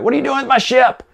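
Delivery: high, quick mock-indignant demand; playful imitation of someone affronted; indignant Han Solo. Retold Han indignant about his ship.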